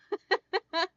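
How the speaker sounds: short high giggle; real little laugh at herself. Real, not acted. Amused at her hair fight.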